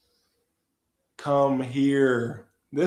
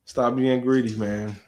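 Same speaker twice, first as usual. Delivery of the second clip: ordinary talk, nothing marked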